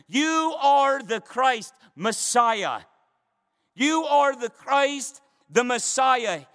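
slow, ringing "You are the Christ"; emphatic declaration. Retold or imitated. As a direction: hold CHRIST high, then land on Messiah. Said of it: "it's emphatic".

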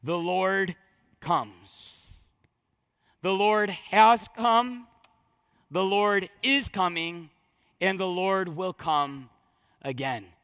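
slow, reverent recitation; solemn intoning. Acted. Preacher solemnly unfolding Maranatha's meaning, slow and hushed.

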